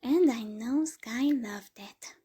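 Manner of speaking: mock sing-song singsong tease. A narrator's reading. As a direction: tease her in a lilting, slow sing-song. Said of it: "in a sing-song voice".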